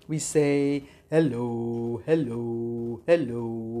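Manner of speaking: very low, deep hello; as low as we can. Shown as a demonstration. Teacher leading toddlers in a low hello. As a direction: drop the voice down as low as it goes. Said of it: "Let's say hello as low as we can".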